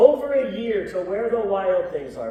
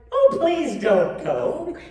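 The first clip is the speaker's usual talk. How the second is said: mock pleading cry; 6 semitones higher, slower than usual. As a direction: plead like the wild things. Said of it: "the wild things cried".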